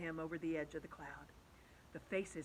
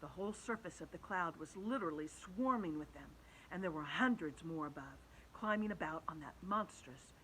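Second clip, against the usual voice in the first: usual speaking voice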